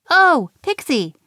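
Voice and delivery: high, loud eager call; bright called-out greeting; excited call. A narrator's reading. Nim calling to Pixie, high and loud.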